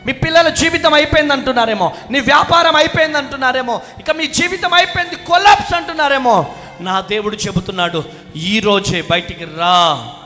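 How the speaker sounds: loud, high, ringing preacher's call; full-force preaching. Acted. Pastor rousing the congregation to clap, preaching at full force. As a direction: rouse the crowd like a revival pastor.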